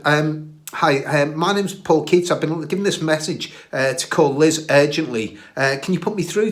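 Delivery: tentative, overly courteous phone voice; nervous polite caller. Retold or imitated. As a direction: sound eager and unsure on the phone. Nervous polite call to stranger.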